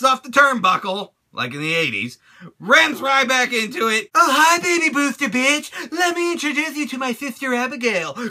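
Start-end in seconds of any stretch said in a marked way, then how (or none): 4.1-8.2 creepy taunting character voice; mock Bray Wyatt. Bray Wyatt taunting Ryback, mocked in a creepy voice. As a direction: taunt him like a creepy cult leader.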